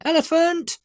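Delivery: high teasing call at the big brother; mock the heavy footsteps with one cheeky yell; teasing shout. A narrator's reading. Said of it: "shouted Zach to his brother".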